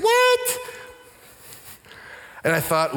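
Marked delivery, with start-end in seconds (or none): 0.0-0.6 very high-pitched shouted disbelief; hammed-up indignant squawk; upset. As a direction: yelp it in outraged disbelief. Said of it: "I was upset. I was like".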